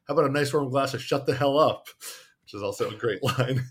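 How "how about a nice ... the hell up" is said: mock snap. Nasty nurse snaps back.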